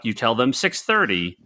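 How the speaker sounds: ordinary talk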